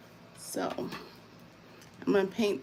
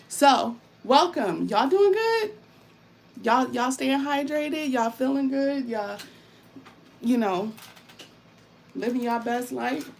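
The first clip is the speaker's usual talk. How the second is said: excited hype; 6 semitones higher, 6 dB louder than usual. Real, not acted. Hyping up the chat.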